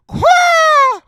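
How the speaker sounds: very high, loud swan-like squawk; wild bird call. Retold or imitated. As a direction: let out a sudden animal cry. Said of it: "I just started to call out".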